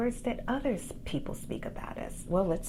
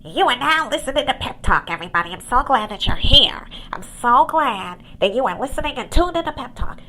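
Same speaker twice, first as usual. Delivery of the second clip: higher, louder radio announcer sell; overhyped host voice; 6 semitones higher, 11 dB louder than usual. Pretend. Mocking her own show intro as an overexcited radio host. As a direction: gush it like a cheesy radio jock. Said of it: "and I started going".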